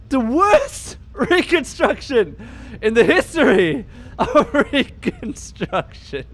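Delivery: high, slow words broken by laughter; helpless, high-pitched cracking up; laughing hard. Real, not acted. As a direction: barely get it out through laughing. Cracking up at bad face reconstruction.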